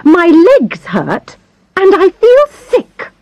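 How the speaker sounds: high, slow, whiny moaning; complaining. Acted. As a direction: pile on the self-pity. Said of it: "she complained".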